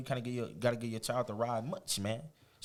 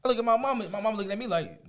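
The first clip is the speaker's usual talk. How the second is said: ordinary delivery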